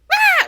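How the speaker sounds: short, loud, high shriek; mock scream. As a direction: scream as if startled awake.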